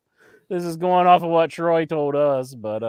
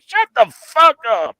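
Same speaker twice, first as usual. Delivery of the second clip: loud, higher mock-angry snap; exasperated retort; 6 semitones higher, 6 dB louder than usual. Co-host talking back to a wrestler's speech, exasperated. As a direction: cut him off with an exasperated yell.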